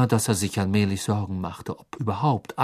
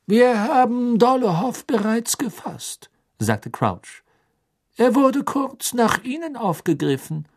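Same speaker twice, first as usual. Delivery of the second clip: strenger Beamter, hoch und laut; kühl fordernd verhören; Crouch; 12 semitones higher, 4 dB louder than usual. Acted. Crouch berichtet. (Crouch fordert Karkaroff streng zum Reden auf)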